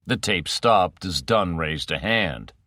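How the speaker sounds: ordinary delivery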